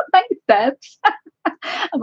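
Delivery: thanks that break into laughter; laughing thanks. Real, not acted. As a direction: laugh at yourself for being so teary. Laughing at her own tears.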